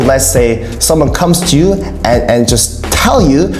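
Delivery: ordinary talk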